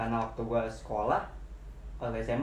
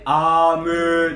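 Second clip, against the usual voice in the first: loud drawn amin; 3 semitones higher, 10 dB louder than usual. Retold or imitated. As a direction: call a long loud amin. Congregation amin in the mock prayer.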